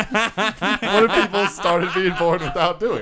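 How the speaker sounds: high, loud laughing delivery; slow, cracking-up question; laughing through words. Real, not acted. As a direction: barely get the joke out through laughter. Joke told while laughing.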